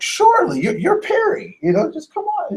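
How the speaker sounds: high-pitched, deferential judge voice; indulgent judge. Acted. Judge granting the famous lawyer's request, high indulgent voice. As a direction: play a judge waving the star lawyer on. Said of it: "and the judge is like".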